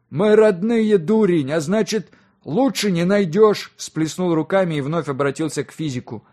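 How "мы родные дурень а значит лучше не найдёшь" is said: loud, high, slowing to drive it home; exasperated retort. A narrator's reading. Father setting his foolish son straight, exasperated. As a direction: scold him as a fool.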